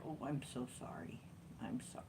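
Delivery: whisper-soft apology as the kitty; gentle cat apology. Retold or imitated. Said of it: "so gentle".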